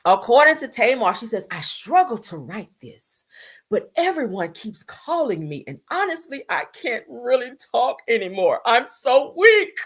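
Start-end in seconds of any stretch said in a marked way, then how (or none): 1.5-9.9 dramatic, drawn-out weepy mock reading. Reading a singer's post to viewers, hammed-up weak drama. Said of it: "I gotta read it in her voice".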